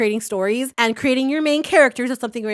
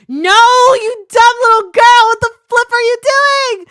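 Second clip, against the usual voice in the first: put-on panicked yelling girl; 12 semitones higher, 9 dB louder than usual. Voicing a panicking webtoon character.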